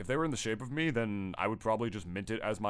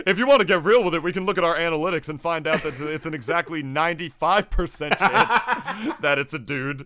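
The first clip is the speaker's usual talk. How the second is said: higher, louder, laughing reveal; excited; 5 semitones higher, 8 dB louder than usual. Real, not acted. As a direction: build it up with a laugh.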